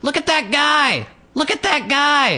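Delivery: high, slow squeal of excitement; toddler girl. Retold or imitated. Storyteller plays a little girl pointing at a stranger, high toddler squeal. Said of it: "and she went".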